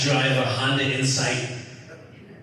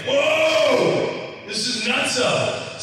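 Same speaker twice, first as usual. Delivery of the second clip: shocked whoa; 12 semitones higher, much slower than usual. Retold or imitated. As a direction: blurt out in shock. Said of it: "I'm like".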